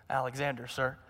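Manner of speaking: small, hushed frightened reply; timid soldier. A narrator's reading. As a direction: barely dare to say your name. Terrified young soldier answers.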